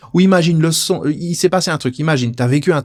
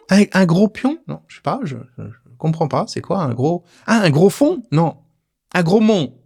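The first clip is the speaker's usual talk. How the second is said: teasing mishearing; 6 semitones higher than usual. Pretend. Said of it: "tu joues un peu avec".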